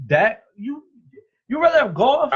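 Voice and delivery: incredulous. Real, not acted. Heated argument over quarterbacks.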